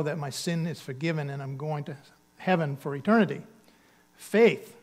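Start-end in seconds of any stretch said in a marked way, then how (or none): 4.2-4.8 loud, punched single word; one-word answer, called out; emphatic answer. Real, not acted. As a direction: land the answer hard. Preacher answers own question.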